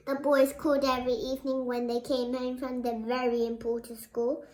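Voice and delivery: usual speaking voice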